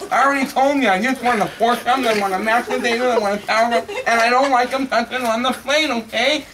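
high, loud, angry list; exasperated rant. Acted. Fed-up guest scolding the waitress about his order, exasperated. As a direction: hammer out each dish at the top of your patience.

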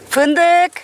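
high, drawn-out name call; calling a pet. Real, not acted. Owner calling the nursing mother animal, high sing-out call.

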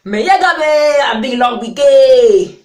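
big loud slow hype opener; loud cheerful welcome shout; shouted greeting. Real, not acted. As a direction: belt out the greeting to the viewers.

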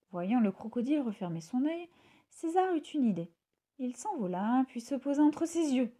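ordinary delivery, nothing marked

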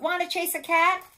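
high, loud, animated invitation; storybook dog. A narrator's reading. Dog asking spider to chase a cat, high and loud. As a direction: pitch it up like an eager dog. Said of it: "barked the dog".

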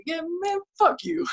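very high put-on voice; high-pitched mock dismissal; imitated heckling stranger. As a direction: imitate him brushing you off, way up high. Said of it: "And he was like".

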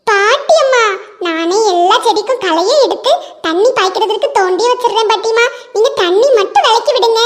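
put-on high child's voice, coaxing; a boy sweet-talking grandma; eager little boy. Ringu coaxes grandma to keep his shovel, high child voice.

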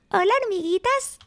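high, tiny mouse voice; ratón muy bajo. Acted. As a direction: greet them in a high little story voice. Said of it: "Y ratón muy bajo decía". (vocecita aguda de ratón saludando)

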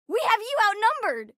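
high, excited challenge; high-pitched combat cry; battle taunt. Acted. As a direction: call it out to the enemy.

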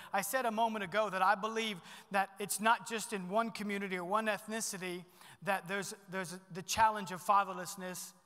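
normal talking voice

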